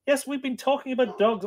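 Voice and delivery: high, sweet voice for the family dog; talking to the dog. Real, not acted. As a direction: coo it to the dog like a pet. Aside to the dog.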